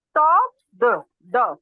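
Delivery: loud "stop", then a detached "duh" for the ending; separated D sound. Shown as a demonstration. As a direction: split "stop" from its final "the", a voiced d. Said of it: "the का जो sound".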